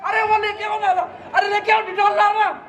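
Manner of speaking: mother crying over her beaten son; frantic mother. Acted. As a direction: fuss and sob over the hurt child.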